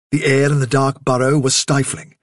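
usual speaking voice

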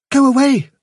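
very high, loud, urgent little voice; instant alarmed reply. A narrator's reading. Fiver telling the chief what to do, alarmed. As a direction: jump in with high-pitched urgency. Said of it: "said Fiver instantly".

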